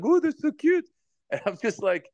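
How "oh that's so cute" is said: very high cooing gush; aunt's cooing. Retold or imitated. Aunt cooing at her fifty-one-year-old nephew, very high. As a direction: gush over him like a baby. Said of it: "She's like".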